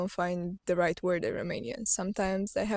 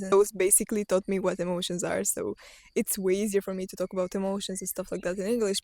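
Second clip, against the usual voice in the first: normal talking voice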